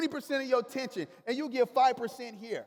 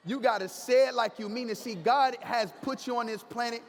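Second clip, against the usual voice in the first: ordinary delivery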